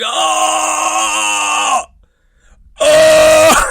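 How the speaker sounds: two loud pained cries of oh; mock scream. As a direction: cry out oh in pain, high and loud. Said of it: "I scream".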